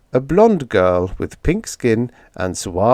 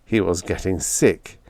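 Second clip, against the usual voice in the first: usual speaking voice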